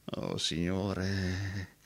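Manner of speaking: slow, soft, wondering; awed hush. Acted. Roberto telling the stranger he saw Alice. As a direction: breathe it out in wonder.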